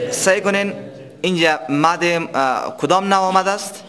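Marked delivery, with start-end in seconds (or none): none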